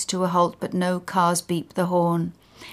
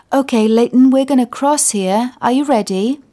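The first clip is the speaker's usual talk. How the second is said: put-on louder, higher call to an old man; 5 semitones higher, 7 dB louder than usual. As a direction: speak up so he hears.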